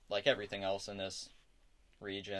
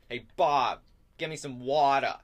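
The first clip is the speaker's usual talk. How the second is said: very loud, higher-pitched holler; yelling at an employee; 6 semitones higher, 14 dB louder than usual. Acted. Barkeeper to employee Bob for a drink, yelling. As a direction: bellow the order across the tavern. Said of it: "he yells at one of his employees".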